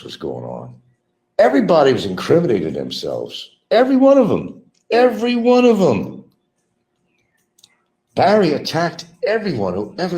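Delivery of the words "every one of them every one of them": high, loud, incredulous repeat; emphatic exclamation. Real, not acted. Ranting about everyone incriminating themselves, high emphatic exclamation. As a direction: drive it home high and loud.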